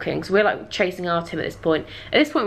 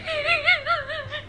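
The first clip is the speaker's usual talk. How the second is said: high squeaky giggling; high-pitched fit of laughs; helpless laughter. Real, not acted. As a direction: crack up completely. Cracking up at camel offer.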